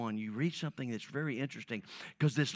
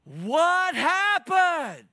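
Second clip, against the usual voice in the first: much higher, louder, drawn out; exasperated cry; 15 dB louder, much slower than usual. Pretend. Pastor playing a baffled husband, stretched high exasperated cry. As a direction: throw up your hands in disbelief.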